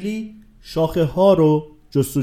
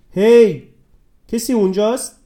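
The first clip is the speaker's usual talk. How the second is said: calling out; 6 semitones higher, 4 dB louder than usual. A narrator's reading. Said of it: "به سوی جنگل فریاد کشید".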